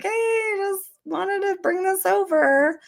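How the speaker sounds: an awkward hello at the door; retold awkward high-pitched hello; awkward greeting. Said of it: "I just was kind of awkward".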